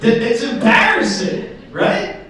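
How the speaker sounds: excited. Real, not acted. Self-mocking after showing feet.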